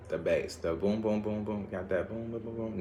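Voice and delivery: muttered boom boom bass sounds; soft boom beat. Pretend. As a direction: hum a bass beat under the breath. Vocalizing bass beat softly.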